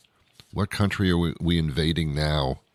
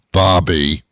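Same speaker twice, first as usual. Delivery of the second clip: louder, lightly mocked self-recorded name; mock voicemail name drop; guy saying his name; 8 dB louder than usual. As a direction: announce your own name into the voicemail, a bit loud. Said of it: "his voice just say his name".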